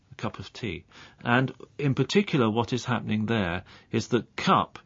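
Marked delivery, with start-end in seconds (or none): 0.1-0.8 "a cup of tea" run together as one word; normal linked speech. Shown as a demonstration. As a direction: link cup into a weak "of", no gaps. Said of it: "the normal way to say that".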